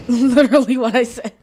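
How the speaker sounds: loud, laughing exasperation; laughing protest. Real, not acted. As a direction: say it through a burst of laughter. Laughs at being echoed.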